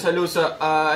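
ordinary talk

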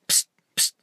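sly, voiceless "psst"; an inner voice trying to get attention; conspiratorial psst. Retold or imitated. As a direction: hiss a secretive psst for attention. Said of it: "the negative voice says to me".

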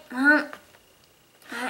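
ordinary talk

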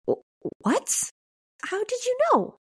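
stammered, high-pitched disbelief; stumble, then leap up high in amazement; astonished. A narrator's reading. Teenager asks how the shopkeeper knew, astonished.

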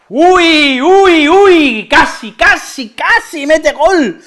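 high, loud, stretched "casi"; near-miss gasp. Real, not acted. Fan watching a near goal, high and loud dismay. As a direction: react to an almost-goal.

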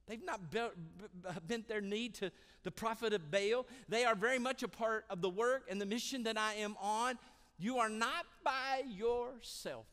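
high, booming preacher's rebuke; loud, emphatic correction at full force; forceful rebuke. Acted. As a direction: thunder it out, slowing hard at the end. God's forceful rebuke of Elijah, preached.

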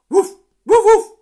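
high, very loud woofs; dog barking. A narrator's reading. Dog joining the farmyard chorus, loud high barking. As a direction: bark out sharp loud woofs. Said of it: "said the dog".

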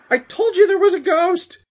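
high, loud, panicked shout; frantic vindicated yell; shouting over engine noise. A narrator's reading. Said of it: "Dusty shouted over the sound".

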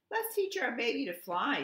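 bright, high Goose voice; excited Goose. A narrator's reading. Goose telling Duck, excited high voice. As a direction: voice the eager goose.

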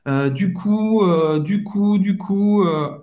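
string "du coup" together like a verbal tic; demonstrating a habitual filler; filler mimicry. Shown as a demonstration. Mimics repeated French filler.

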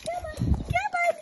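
squeaky coaxing. Real, not acted. Coaxing dog.

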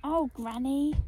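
a fond, happy call to granny; affectionate smile. Acted. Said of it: "I smiled".

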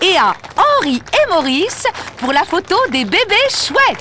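high-pitched prize announcement; madame le maire. Acted. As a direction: announce the joint winners to the crowd. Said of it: "Madame le maire va annoncer le résultat". (annonce aiguë de madame le maire)

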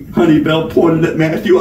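ordinary delivery, nothing marked

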